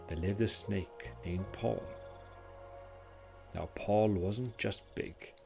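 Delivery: ordinary delivery, nothing marked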